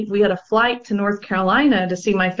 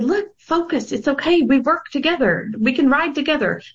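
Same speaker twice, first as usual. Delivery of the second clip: calming retold plea; 5 semitones higher than usual. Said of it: "I leaned down and I said".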